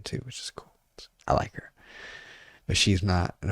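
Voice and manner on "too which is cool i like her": soft confiding whisper; whispered aside. Real, not acted. Whispering to the co-host about a favorite. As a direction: say it like a secret. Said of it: "whispered aside".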